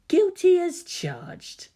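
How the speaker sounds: high, slow and sultry; slow, teasing seductive admission; lusty coy voice. A narrator's reading. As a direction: purr it, pleased with himself. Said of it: "the lusty pitch of that tone".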